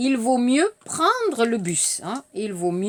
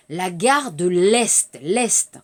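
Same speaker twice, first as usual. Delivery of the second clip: slow careful model; 7 semitones lower, slower than usual. Shown as a demonstration. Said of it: "Je répète".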